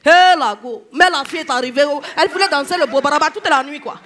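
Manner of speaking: loud, temperamental Ivorian woman impression; exuberant Ivorian accent. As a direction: let rip like a feisty Ivorian woman. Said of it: "elles sont très exubérantes".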